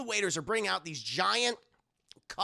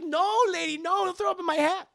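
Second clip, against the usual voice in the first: put-on high kid voice; 11 semitones higher, 5 dB louder than usual. Short Round's high voice.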